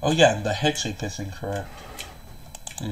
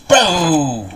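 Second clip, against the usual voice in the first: scare shout; 5 semitones higher, 6 dB louder than usual. Acted. Jumps out to scare them.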